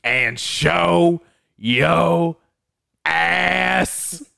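very slow, drawn-out movie sheriff; Bill Sharp. Acted. Friend acting out Django's opening, drawling Bill Sharp. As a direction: stretch every word, pausing before the last ones. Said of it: "I like Bill Sharp at the beginning".

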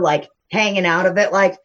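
ordinary talk

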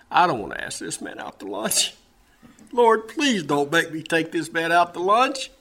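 mock pleading, words stretched out; reluctant plea. Man begging the Lord to excuse him from lunch, reluctant plea. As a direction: beg to be let off.

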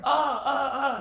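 high, loud imitated cries of pain; imitated pained yelps; mock pain cries. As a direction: yelp like an injured jumper. Injured jumpers crying out.

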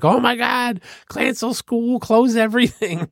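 high, mock-panicked squeal; shrill-free mock panic way up high; panicked southerners. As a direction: ham up the locals panicking over a dusting. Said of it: "they're like".

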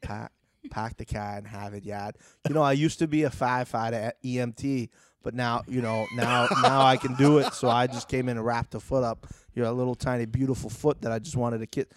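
put-on Boston accent, dropped r's; Boston accent, car as kah. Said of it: "Her Boston accent is even weirder".

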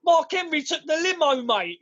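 a cheeky London cab driver; mock cockney driver. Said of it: "And he goes".

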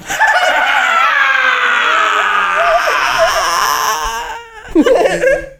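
long high howling laugh. Real, not acted. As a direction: crack up completely. Cracking up at the memory.

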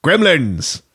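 rising to a high, loud shout; battle cry. Retold or imitated. Gremlin leader rallying his troops, shouted. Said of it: "Fraser Crane gremlin is like".

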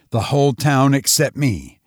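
usual speaking voice

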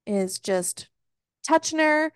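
usual speaking voice

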